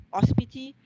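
ordinary talk, nothing marked

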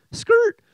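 very high, loud "skrrt"; imitated tire screech. Preacher halts his Bible reading, loud high screech. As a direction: screech like brakes slammed on.

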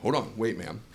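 low, hesitant worker stalling the customer; Subway worker impression. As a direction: stall the customer like a nervous clerk. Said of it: "my impression of somebody who works at Subway".

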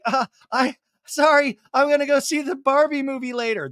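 high, fast flustered excuse; awkward assistant voice. Retold or imitated. Ann dodging Sandoval, high flustered voice. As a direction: scramble for an excuse to leave. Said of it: "she's like".

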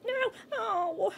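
high, loud wail of dismay; dismayed cry. Real, not acted. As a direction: cry out as it goes wrong. Game going wrong.

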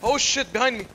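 high loud panic; panicked shout. Real, not acted. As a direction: shout a sudden warning. Enemy behind player.

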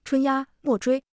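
slow, drawn-out narrated call; restrained shout. A narrator's reading. Xu Da calls Chunya off the chase, restrained drawn-out shout. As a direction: call her off the chase without full volume. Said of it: "徐达大喊".

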